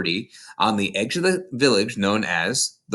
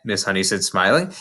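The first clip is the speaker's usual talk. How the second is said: plain reading voice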